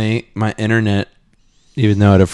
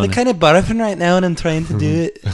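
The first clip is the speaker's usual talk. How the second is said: mock English accent; 8 semitones higher than usual. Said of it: "Good effort, though".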